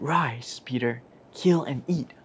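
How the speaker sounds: hushed whisper, barely voiced; whispered voice of God. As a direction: whisper the command like a voice from heaven. God's voice speaks again.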